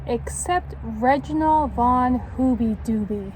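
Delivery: put-on lively read-aloud to children; story narration. A narrator's reading. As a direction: tell it like a picture book. Reads picture book to children.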